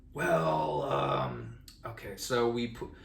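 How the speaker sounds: low, drawn-out hedging; reluctant hesitation. Retold or imitated. Friends asked what happened that night, reluctant. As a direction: imitate friends stalling before bad news, voice dropping low.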